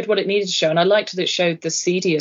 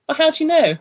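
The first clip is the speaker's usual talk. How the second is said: quoting the doctor's surprised reply, pitched up high; mock incredulous question; 10 semitones higher, faster than usual. Friend retelling the film scene, higher incredulous voice. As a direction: voice jumps up high for the quoted reply. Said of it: "when she was like".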